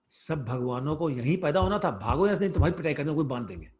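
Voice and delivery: rapid-fire scolding in Hindi; mock-furious Hindi telling-off; retold angry mother. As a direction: act out a mother's rushed, loud threat. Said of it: "say what Mother Yashoda said in Hindi".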